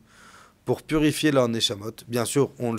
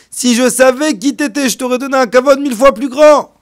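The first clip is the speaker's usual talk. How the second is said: high, loud, anguished self-reproach; host loudly crying out his remorse; distraught loud lament; 10 semitones higher, 10 dB louder than usual. Acted. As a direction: wail your regret at him. Host begging forgiveness, distraught.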